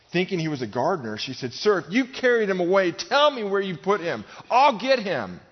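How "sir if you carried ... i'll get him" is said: high, loud, urgent begging; desperate plea. Acted. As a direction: plead frantically with a stranger for an answer. Acting Mary pleading with supposed gardener.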